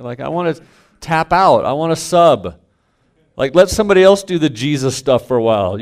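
husband telling his wife he wants out; exasperated. Retold or imitated. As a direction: beg to tap out, half joking. Retold venting to wife.

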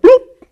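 high, loud popped bloop; sudden squeaky-high imitated plop; comic bloop. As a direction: pop it out as a cartoon sound effect. Imitated noise of foot going in mouth.

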